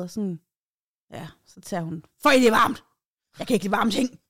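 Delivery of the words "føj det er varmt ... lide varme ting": disgusted outburst. Retold or imitated. Woman spits out hot shot.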